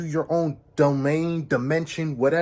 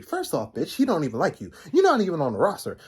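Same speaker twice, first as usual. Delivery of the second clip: ordinary talk, nothing marked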